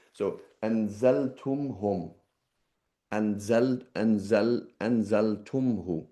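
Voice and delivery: anzaltumhum said slowly, stumbling on anzal; halting over anzaltumhu; slow halting demo of the hard form; awkward cluster. Shown as a demonstration. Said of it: "That's quite awkward".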